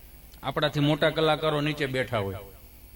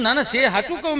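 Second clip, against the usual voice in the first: insistent protest; 8 semitones higher, 6 dB louder than usual. Retold or imitated. Teller insists to old man.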